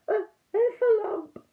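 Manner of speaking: high, loud squeak of terror; frightened cry. Acted. Piglet crying out to Christopher Robin in fright. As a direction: Piglet shrieks out his fear, high and slow.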